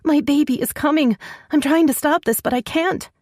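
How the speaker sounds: panicked. Acted. Panicked woman in labour.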